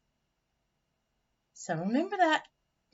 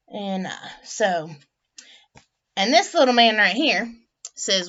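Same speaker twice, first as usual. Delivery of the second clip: ordinary delivery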